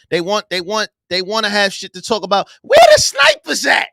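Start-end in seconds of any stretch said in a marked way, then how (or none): 2.6-3.9 high, loud playful yell for the snipers; rally the troops, shouting at the top of the voice; hammed-up shout calling in snipers; mock battle yell. Mock call for snipers, yelling.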